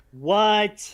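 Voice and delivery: loud, high astonished "what"; retold shock. Co-host hearing Sherman is not at work, loudly astonished. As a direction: burst out in exaggerated disbelief.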